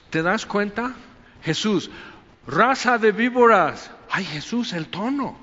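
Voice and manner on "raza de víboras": harsh rebuke. Retold or imitated. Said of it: "No, pero el tono".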